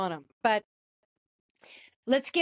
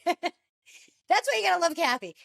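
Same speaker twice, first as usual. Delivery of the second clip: laughing, higher, gushing; 6 semitones higher than usual. Real, not acted. Host laughing while praising Kathy's reunion answer. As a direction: laugh it out fondly.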